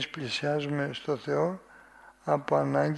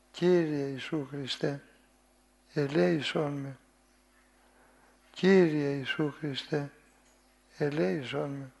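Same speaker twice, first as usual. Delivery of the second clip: humble pleading prayer. Shown as a demonstration. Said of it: "με φωνή παρακλητική και οικετευτική".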